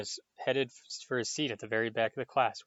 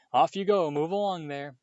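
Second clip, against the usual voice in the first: higher, louder call to shoo the crowd; 6 semitones higher, 6 dB louder than usual. A narrator's reading. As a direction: send the crowd off loudly. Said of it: "Lockhart called to the crowd".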